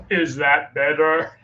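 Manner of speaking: slow, drawn-out spooky voice; scary voice. Shown as a demonstration. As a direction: drag it out like a lurking monster. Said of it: "That's my scary voice".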